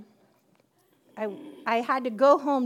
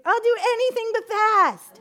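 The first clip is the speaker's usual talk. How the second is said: higher, louder exasperated refusal; loud protest; 10 semitones higher, 8 dB louder than usual. Retold or imitated. Woman refusing God's call to fast, exasperated. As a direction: balk at the idea, loud.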